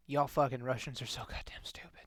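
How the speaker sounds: whispered aside insult; whispering. Real, not acted. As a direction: drop to a sneering whisper.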